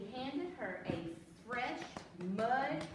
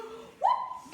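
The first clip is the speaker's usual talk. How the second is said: sudden surprised whoop; 7 dB louder than usual. Acted. Balloon pops.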